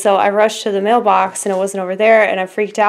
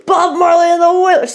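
excited shout; 9 semitones higher than usual. Real, not acted. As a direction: shout it out in delight. Reading out CD titles.

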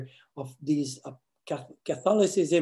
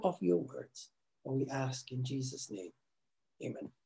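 quiet prayer; 2 semitones lower, 4 dB quieter than usual. Real, not acted. Pastor opening the Bible study with a prayer, hushed and reverent. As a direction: pray humbly over the listeners.

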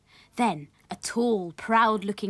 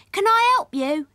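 high, loud, eager little voice; eager child character; 13 semitones higher, 9 dB louder than usual. A narrator's reading. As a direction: pipe up as a keen child wanting to help. Sammy eagerly offers help in story.

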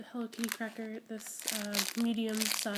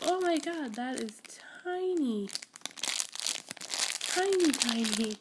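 amazed. Real, not acted. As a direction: squeal at how tiny it is. Tiny falafel squishy surprise.

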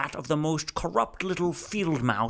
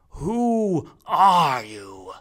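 booming question; 4 semitones higher, 9 dB louder, much slower than usual. Acted. Rumford questions from treetop.

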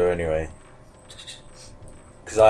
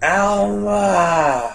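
high, far-off ghostly wail of a name; spooky calling; 11 semitones higher, 3 dB louder than usual. Pretend. Friend mimicking the game ghost's haunting wail.

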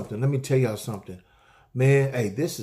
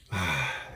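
long audible sigh out, lower; breathing out; 8 semitones lower, 6 dB quieter than usual. Shown as a demonstration. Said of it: "and breathe out".

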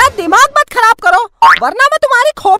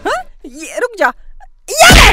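angry scolding; 5 semitones higher than usual. Acted. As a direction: scold while hitting him. Mother scolding while beating son. (मां का गुस्से में डांटना)

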